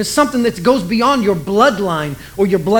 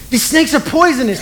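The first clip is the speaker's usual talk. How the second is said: ordinary talk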